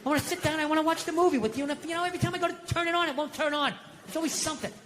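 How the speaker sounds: acted aggrieved dad, hushed and hurried; whining excuse. Dad whines his excuse to child.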